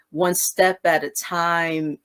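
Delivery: drawn out very slowly; slow pace. Shown as a demonstration. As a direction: stretch each word, one slow step after another. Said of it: "we're operating at two different paces".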